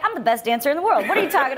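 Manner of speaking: fast, laughing mock boast; mock ego. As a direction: brag like a wounded ego, half joking. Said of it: "my ego came out".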